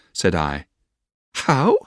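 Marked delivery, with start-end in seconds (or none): 1.3-1.8 high-pitched "how", dropping to settle it; pretty, airy little answer; Dora. Acted. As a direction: toss it off lightly, starting high. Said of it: "said Dora".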